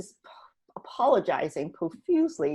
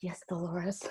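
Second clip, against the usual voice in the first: whispered, meek yes to a stern teacher; sheepish whispered agreement; 12 dB quieter than usual. Retold or imitated. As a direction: whisper it like a scolded pupil. Meekly giving in to Dolores.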